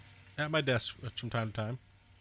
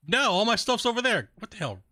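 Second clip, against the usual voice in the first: higher, louder exasperated complaint; frustrated outburst; 9 semitones higher, 4 dB louder than usual. Real, not acted. Streamer annoyed his gear moved, exasperated and louder. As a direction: blurt it out, annoyed at the game.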